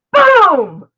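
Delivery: loud high burst, a sudden reveal; excited boom. Acted. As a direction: pop it out like a surprise drumroll. Sudden surprise announcement.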